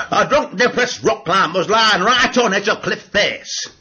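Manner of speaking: Yorkshire stand-up delivery; Yorkshire accent. Acted. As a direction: joke told in a Yorkshire accent. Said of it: "in my Yorkshire accent".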